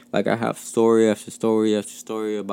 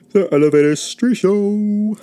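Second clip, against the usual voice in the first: much higher, louder, slow hype announcer; show announcer; 10 semitones higher, 5 dB louder, slower than usual. Acted. Host plugging his show's Instagram, hype announcer. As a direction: boom the show name like a host.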